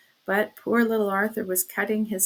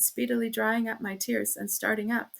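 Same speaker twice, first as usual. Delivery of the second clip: plain reading voice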